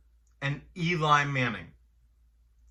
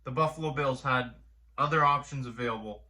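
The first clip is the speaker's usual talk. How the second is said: ordinary delivery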